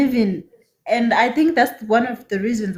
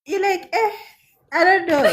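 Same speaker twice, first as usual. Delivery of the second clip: laughing, higher; kind gentle laugh; 7 semitones higher than usual. Real, not acted. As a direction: giggle through it. Laughing about commenting.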